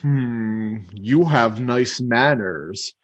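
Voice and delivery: slow dragon, low then rising; Smaug. Acted. Smaug calling the burglar thief and liar, slow menacing voice. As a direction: rumble slowly, then swell on the accusation. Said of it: "he's talking in a deep voice".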